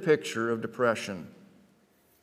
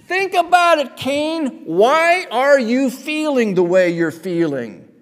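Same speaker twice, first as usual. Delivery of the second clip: higher, louder pleading voice of God; God reasoning with Cain, raised and urgent; voicing God; 11 semitones higher, 7 dB louder than usual.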